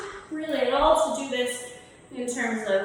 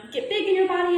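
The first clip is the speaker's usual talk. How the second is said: big and bold; 7 semitones higher, 5 dB louder, faster than usual. Shown as a demonstration. Teacher showing the class how big feels, said big and quick. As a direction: say it big and quick, a little louder. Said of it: "When I say get big".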